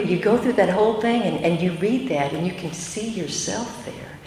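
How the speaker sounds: ordinary delivery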